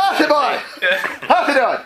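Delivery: high, loud boisterous hello; loud rowdy greeting. Acted. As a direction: boom the greeting at the whole room. Rowdy NPC greeting loudly.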